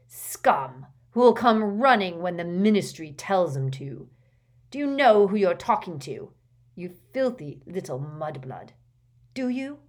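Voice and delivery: loud, indignant rant; angry tirade. A narrator's reading. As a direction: hurl the insult in outrage. Father's tirade at official.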